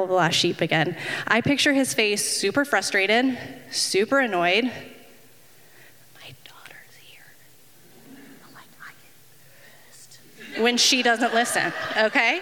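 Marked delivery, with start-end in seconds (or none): 4.6-8.6 whispered aside, barely voiced. Real, not acted. Whispering to the congregation that her daughter is present. 8.8-10.2 breathy whispered confession. Real, not acted. Whispering a swear word to the crowd, hiding it from her daughter.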